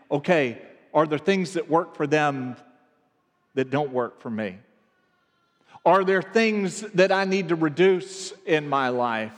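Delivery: usual speaking voice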